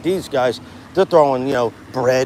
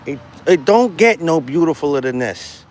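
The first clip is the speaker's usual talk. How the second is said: emphatic admiration; 3 semitones higher, 4 dB louder than usual. Real, not acted. Streamer admiring the view at the water, emphatic. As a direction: marvel out loud, leaning on "get".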